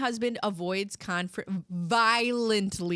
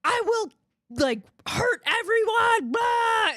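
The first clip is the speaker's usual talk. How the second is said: high, loud hammed-up threats shouted; mock furious shout; 11 semitones higher, 6 dB louder than usual. Husband on hold yelling threats, high hammed-up shouting. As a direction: rage theatrically at the cable company.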